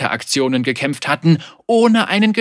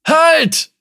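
high, loud call to stop; alarmed shout; 7 dB louder than usual. Acted. Man in the boat's bow stopping the captain, alarmed shout. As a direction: cry out a warning at full voice. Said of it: "rief ein Mann".

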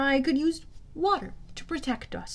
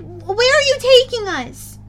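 loud, high, drawn-out demand; alarmed girl demanding to know; 11 semitones higher, 9 dB louder than usual. A narrator's reading. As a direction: insist on an answer at raised pitch. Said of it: "Bianca demanded".

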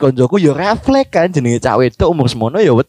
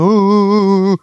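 mock boo-hoo sobs, pitched up; imitated crying; 3 semitones higher than usual. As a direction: fake a child's wailing. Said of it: "nangis ngono". (niru tangise bocah)

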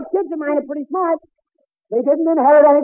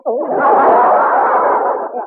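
loud laugh; 4 dB louder than usual. Real, not acted. Laughing at insult.

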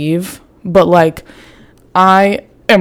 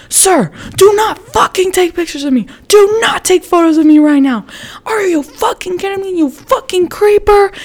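very high-pitched, hammed-up yelling, volume reined in; retold top-of-lungs screaming; 12 semitones higher than usual. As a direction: reenact a screeching tirade at the creep. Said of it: "screaming at the top of my lungs".

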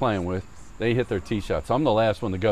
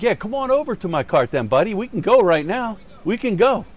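high, raised-voice imitation of squaring up; mock fighting challenge; 9 semitones higher, 4 dB louder than usual. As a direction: call someone out, pitched up and getting louder.

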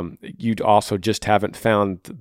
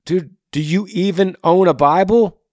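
raised, louder incredulous question; incredulous challenge; 7 semitones higher, 6 dB louder than usual. Real, not acted. Host challenging a listener about his faith, incredulous. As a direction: challenge him in disbelief, pitched up. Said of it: "And I'm saying".

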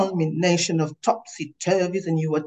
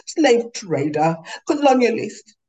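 louder, higher, slowed and biting; wounding insult; 5 semitones higher, 4 dB louder than usual. Acted. Swimmer taunting the ignoring woman, louder wounding scorn. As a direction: hurl each word to wound. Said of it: "you aimed to wound words".

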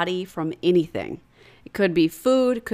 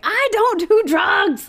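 high, slow disbelieving protest; shocked protest; 14 semitones higher, 3 dB louder, much slower than usual. Retold or imitated. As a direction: insist in disbelief. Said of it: "I was like".

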